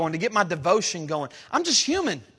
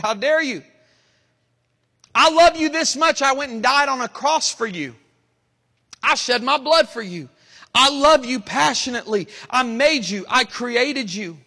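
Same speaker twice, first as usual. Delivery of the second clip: God's passionate rebuke; 5 semitones higher, 4 dB louder than usual. Retold or imitated. God rebuking his people: loud, higher, passionate plea. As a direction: voice of God, higher and louder, pleading with fervour. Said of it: "but to me, He said".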